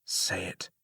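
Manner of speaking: soft inner whisper. Acted. Tuo speaking inside the narrator's mind, whispering. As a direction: whisper it like a voice in the head.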